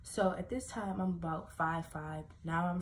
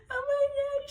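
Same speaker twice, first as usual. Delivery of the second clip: much higher, louder panicked cry; a frightened twelve-year-old crying out; retold panic; 8 dB louder than usual. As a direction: cry out in fear. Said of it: "I'm crying at this point".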